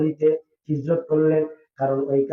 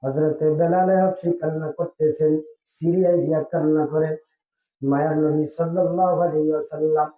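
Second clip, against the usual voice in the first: ordinary talk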